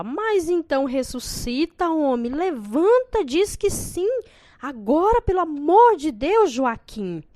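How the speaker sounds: high, loud begging; desperate pleading. A narrator's reading. Bride begging her dead groom to answer, high and loud. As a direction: plead with him to say yes.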